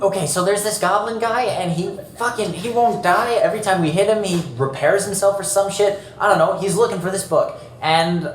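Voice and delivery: high, frantic babble; freaked-out adventurer; panicked rant. Acted. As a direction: spill it all out in a panic. Player panics in character.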